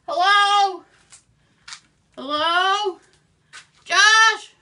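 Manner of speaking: scared calling out. Acted. Alone in the scary basement, calling for Josh, loud and frightened.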